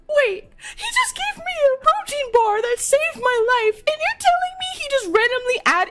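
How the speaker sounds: very high-pitched incredulous protest; high mock-outraged wail; outraged disbelief. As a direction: squeal in hammed-up disbelief. Retold outraged protest to dealer.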